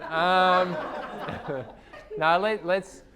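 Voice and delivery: laughing. Real, not acted. Mediator breaking up in role.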